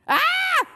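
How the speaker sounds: high, loud shriek; scream. A narrator's reading. Peter sees the ketchup-covered teachers, high scream. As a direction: scream in sudden panic. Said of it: "screamed Perfect Peter".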